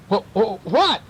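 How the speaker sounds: startled awake. A narrator's reading. Aunt woken by cats jumping on her bed, startled. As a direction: stammer it, jolted out of sleep.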